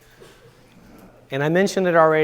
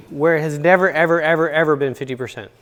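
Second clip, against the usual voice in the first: "never, ever, ever, ever" hammered louder; emphatic repeated ever; 2 semitones higher, 5 dB louder than usual. Real, not acted. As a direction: pound each "ever" home. Preacher denies divorce statistic emphatically.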